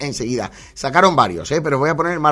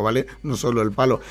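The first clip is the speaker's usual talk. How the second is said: ordinary talk, nothing marked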